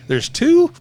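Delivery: a high, loud incredulous question; shocked disbelief. Retold or imitated. Teammate asks in disbelief about the other founder, high and loud. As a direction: squeak out the shock. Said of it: "I'm like".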